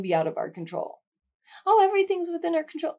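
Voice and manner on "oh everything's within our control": high, quick mimicking voice; airy, higher-pitched mock confidence; mock complacency. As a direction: breezily parody someone naively sure of things. Mimics naive belief, then undercuts it.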